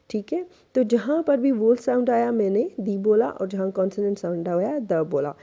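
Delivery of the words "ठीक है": ordinary talk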